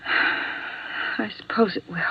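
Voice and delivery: breathy whispered resignation. Acted. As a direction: barely voice it, slow and defeated.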